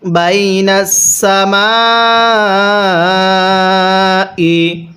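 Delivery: Binance সামাই very slow, the মি stretched long; tajweed recitation. Shown as a demonstration. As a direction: hold the long vowel like a chant. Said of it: "তাহলে কিভাবে পড়ব?". (দীর্ঘ টান দিয়ে তিলাওয়াতের সুরে)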